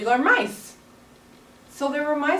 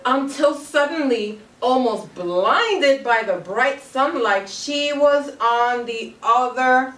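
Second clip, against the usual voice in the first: slow, louder dramatic build to the reveal; building suspense; 3 semitones higher, 6 dB louder, slower than usual. Acted. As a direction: draw it out, louder, toward the big moment. Teacher builds suspense, class joins.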